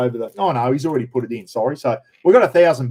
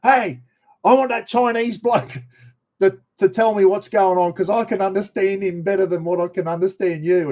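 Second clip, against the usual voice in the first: put-on much higher, excited voice of the young prisoner; 6 semitones higher than usual. Prisoner telling Dave he prefers the Chinese bloke, high excited voice. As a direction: pitch it way up, like a lad fed up with the accent.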